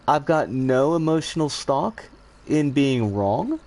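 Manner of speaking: normal talking voice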